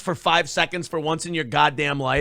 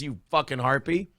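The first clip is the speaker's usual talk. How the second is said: normal talking voice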